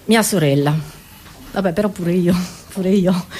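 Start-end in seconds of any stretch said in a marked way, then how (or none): none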